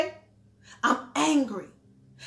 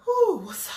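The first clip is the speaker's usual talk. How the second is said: calming woosah breath; 4 semitones higher than usual. Shown as a demonstration. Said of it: "I had to do a woosah".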